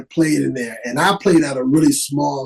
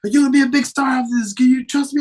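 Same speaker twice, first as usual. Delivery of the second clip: fast pep talk; 10 semitones higher, much faster than usual. Retold or imitated. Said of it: "He said".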